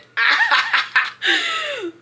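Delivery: shrieking with laughter; high laugh. Real, not acted. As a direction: burst of high-pitched laughter. Laughs at recorded clip.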